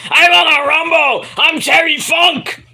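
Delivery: high, loud, raspy hype yell; yelling to Austin as Terry Funk; Terry Funk shout. Retold or imitated. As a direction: roar it like a crazed old wrestler. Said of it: "smacking him around".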